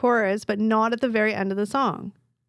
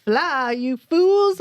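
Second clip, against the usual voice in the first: slow, dramatic wizard warning; Gandalf voice; 4 semitones higher, 3 dB louder, much slower than usual. Pretend. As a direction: stretch it out like an urgent wizard. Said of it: "I just felt like saying it".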